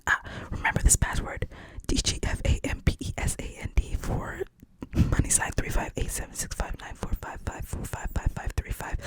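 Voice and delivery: hushed, unvoiced storytelling; whispered movie recap; whispered retelling. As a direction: tell it all in a whisper.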